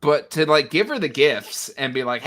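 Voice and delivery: normal talking voice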